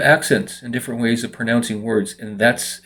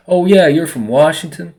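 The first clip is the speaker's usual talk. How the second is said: higher-pitched imitation of a Southerner; Southern-accented greeting, pitched up; 6 semitones higher, 4 dB louder than usual. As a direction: lightly mimic a Southern American accent. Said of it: "'cause that's how they talk".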